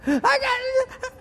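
high, hammed-up sobbing gasp; choked-up puppy owner. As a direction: gasp and fake-sob at the check.

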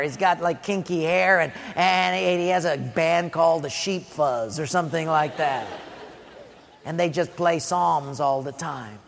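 low, quiet, hesitant hedging; reluctant father. Acted. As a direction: mumble apologetically about your kid. Reluctant Jesse describing his youngest.

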